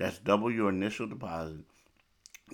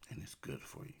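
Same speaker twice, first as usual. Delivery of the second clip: whispered; 3 semitones lower, 17 dB quieter than usual. Real, not acted. Soft aside.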